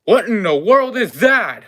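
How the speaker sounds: loud, high startled cry; shocked, high-pitched outburst; startled exclamation. Acted. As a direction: burst out loudly in alarm, voice swooping up. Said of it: "and I go".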